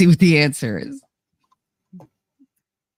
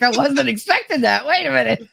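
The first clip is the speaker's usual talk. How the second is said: mock surprise; 9 semitones higher than usual. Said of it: "He's like".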